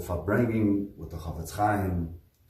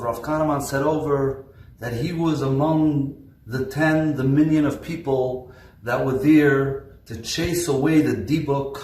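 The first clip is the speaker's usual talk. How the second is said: louder, higher preaching, building; rising sermon fervor; 6 semitones higher, 7 dB louder than usual. Acted. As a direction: preach it with mounting fire. Preacher rises to full force.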